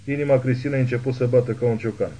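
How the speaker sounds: plain reading voice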